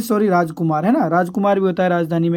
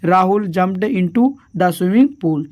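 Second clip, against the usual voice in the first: slow dictation; much slower than usual. Shown as a demonstration. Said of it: "क्या आ जाएगा इसमें".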